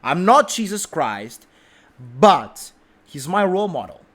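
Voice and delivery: normal talking voice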